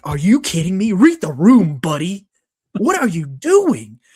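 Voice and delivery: exasperated outburst. Retold or imitated. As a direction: scold him: read the room, buddy. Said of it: "And I'm like".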